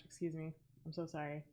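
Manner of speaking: soft embarrassed apology; embarrassed quiet. Real, not acted. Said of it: "Oh my gosh".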